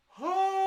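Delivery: high, loud huh thrown away. Shown as a demonstration. Coach demonstrating megaphone mouth, loud huh. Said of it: "that huh should open up that vertical space".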